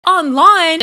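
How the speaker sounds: high, snide mimicry of Anna; mocking Anna impression. Anna taunting DJ Sky High, in a high mocking put-on voice. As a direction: sneer it out in a put-on voice.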